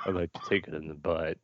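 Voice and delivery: low, soft creepy come-on voice; Scott Lobdell's creepy voice. Retold or imitated. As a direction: murmur it like a sleazy creep. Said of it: "in Scott Lobdell's creepy voice".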